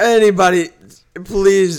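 high, loud desperate plea; panicked pleading. Acted. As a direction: beg for help in panic. Improv victim begging.

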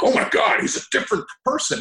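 very high, gushing imitation; mock amazement. People reacting to actor's shaved beard, mock astonished. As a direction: squeal it in fake shock.

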